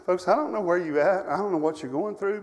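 ordinary talk

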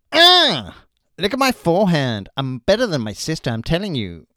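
loud grunting tennis player impression; grunt, then boast loudly about the forehand; Serena Williams. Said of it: "It's Serena Williams".

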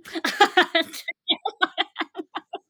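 high-pitched giddy laugh. Real, not acted. Recalling her acceptance to viewers, high laughter.

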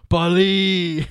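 lightly voiced Italian "Bali"; Italian imitation. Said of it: "The only thing he can do is Italian".

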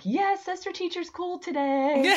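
put-on high, slow kid voice; excited kids. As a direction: gush like a delighted student. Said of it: "So they're like".

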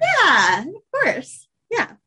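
high sarcastic "of course". Real, not acted. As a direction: drip with sarcasm. Sarcastic reply to 'that makes it better'.

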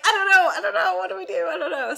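high, loud burst of indecision easing off; mock panicked dithering. Player re-enacting hesitation over game choices, mock panic. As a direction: dither in an exaggerated fluster.

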